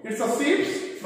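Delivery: sheeps with an added final s, asked as a question; teacher voicing the wrong plural sheeps. Shown as a demonstration. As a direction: say sheeps slowly, tempting the wrong answer. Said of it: "No, it's wrong".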